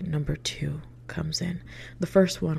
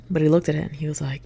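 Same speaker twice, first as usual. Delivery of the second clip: usual speaking voice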